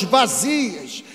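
ordinary talk, nothing marked

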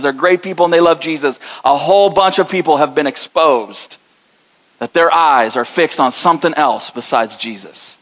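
ordinary delivery

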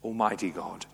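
ordinary delivery

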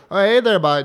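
put-on Canadian accent. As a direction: tease with a hammed-up Canadian accent. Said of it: "I did not sound like that".

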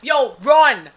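loud urgent yell; shouting. Real, not acted. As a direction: bark it as a warning. Hyped warning about friend's stare.

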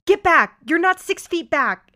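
high, loud retold yell; awkward yell. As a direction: imitate a stranger yelling at you to back off. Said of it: "she awkwardly yells".

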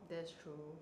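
normal talking voice

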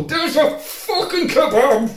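high, drawn-out mock-furious yell; hammed-up angry roar; exasperated outburst. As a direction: explode at him, stretching the words. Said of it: "all he wanted to do was just go".

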